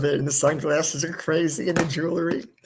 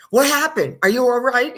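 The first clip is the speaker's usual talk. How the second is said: higher, louder fussing alarm; 7 semitones higher, 5 dB louder than usual. Retold or imitated. Host imitating herself fussing over her surprised friend. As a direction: fuss over him like a worried mother.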